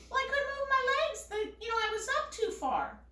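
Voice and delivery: high, quick mock excuse; rushed high-pitched mimicry; defensive mother imitation. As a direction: imitate a flustered mother protesting.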